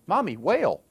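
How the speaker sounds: put-on high-pitched small child's exclamation; little child. Six-year-old walking with mom through the split sea, childlike. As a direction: imitate an amazed child pointing something out. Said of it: "little seven-year-olds or six-year-olds are walking".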